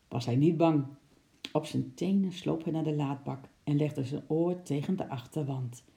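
plain reading voice

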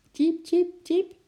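very high squeaky tjiep; squirrel squeak. A narrator's reading. Little squirrel Eki calls out lonely, high squeaky peep. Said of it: "piepte Eki".

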